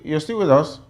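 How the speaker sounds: ordinary talk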